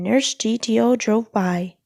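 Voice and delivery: ordinary delivery, nothing marked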